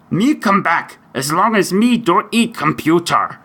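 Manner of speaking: high monster puppet voice; Cookie Monster. Pretend. Host finally lands the answer, Cookie Monster impersonation. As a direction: deliver the punchline as a puppet monster. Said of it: "do my best Cookie Monster imp-, impersonation".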